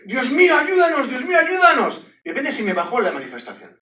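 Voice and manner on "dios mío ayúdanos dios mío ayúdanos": súplica desesperada. Retold or imitated. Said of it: "ella dijo".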